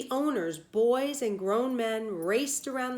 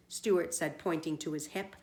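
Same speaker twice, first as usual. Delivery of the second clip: plain reading voice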